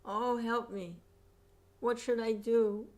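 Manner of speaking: high, loud plea; cry for help. Acted. Cruel person in pain calls out for help, high and loud. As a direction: call out helplessly. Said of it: "They're forced to call out".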